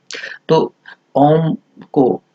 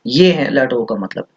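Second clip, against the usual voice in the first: normal talking voice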